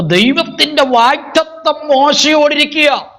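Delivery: high, declaiming sermon voice; fervent preacher's declamation; full-force preaching. Acted. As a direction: proclaim it to the congregation on a high, sustained pitch.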